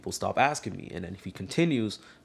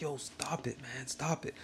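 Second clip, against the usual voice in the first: hushed telling-off; 13 dB quieter than usual. Retold or imitated. Student to disruptive classmate during class, hushed telling-off. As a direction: quietly warn him off mid-class.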